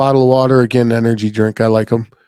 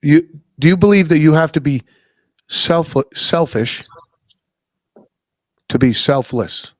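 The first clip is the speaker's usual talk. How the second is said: deliberate repeat; 2 semitones higher than usual. Real, not acted. Would you say that again.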